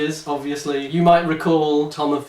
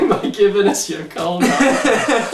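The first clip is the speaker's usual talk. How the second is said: laughing cheeky plea; 6 semitones higher, 5 dB louder than usual. Real, not acted. Host begging listeners for money, laughing. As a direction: giggle through the cash demand.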